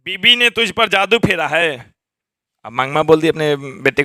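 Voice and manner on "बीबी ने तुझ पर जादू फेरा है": mother's voice pitched up, scolding her son; retold scolding mother. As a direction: scold like an aggrieved old mother. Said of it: "कि मुंह से निकल गई". (बेटे को डाँटती माँ की आवाज़)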